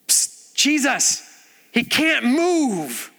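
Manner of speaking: drawn-out urgent psst, slow; slow loud stage whisper down from the roof. Retold or imitated. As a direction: hiss it down to Jesus, slow and pointed. Friends hiss from the roof.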